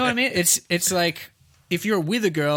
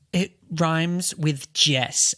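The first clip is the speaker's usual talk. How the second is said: slow, deliberate; much slower than usual. Retold or imitated. Retold girl, slow.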